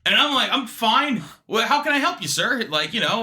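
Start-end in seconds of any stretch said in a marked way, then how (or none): 0.5-2.6 high-pitched, over-nice phone agent; polite customer service. Retold or imitated. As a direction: chirp a helpful customer-service reply. Polite customer service reply, acted.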